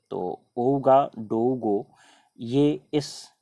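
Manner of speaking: ओगाडोगो stretched out, each syllable separate; slow drilled name. Shown as a demonstration. Teacher repeating the hard capital slowly for students. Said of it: "दिमाग थोड़ा सा ज़्यादा concentrate करना पड़ जाता है".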